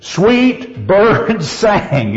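"Sweet birds sang" slow and high, each word stressed; three accents in a row. Shown as a demonstration. Teacher shows the line's ending, "Sweet birds sang" all stressed. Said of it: "We got three accents lining up".

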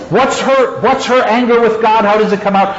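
ordinary delivery

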